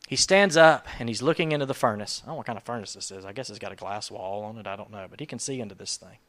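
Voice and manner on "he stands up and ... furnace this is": ordinary talk, nothing marked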